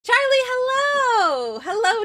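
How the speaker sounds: high, called-out hello; high-pitched whoop of greeting; excited greeting. Real, not acted. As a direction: cry out a delighted welcome. Calls greeting to arriving viewer.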